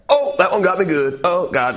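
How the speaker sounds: high, strained mock groan of pain; stabbed salesman. Knife show host jabbed in the chest, high pained groans. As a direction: play him just stabbed by his sword. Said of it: "he's like".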